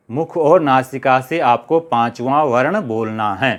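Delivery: normal talking voice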